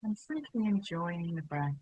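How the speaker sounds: unhurried, even, spacious delivery; guided meditation. Real, not acted. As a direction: guide them gently into settling, phrase by phrase. Leads a settling meditation.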